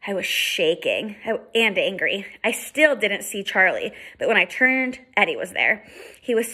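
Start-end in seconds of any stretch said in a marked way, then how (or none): none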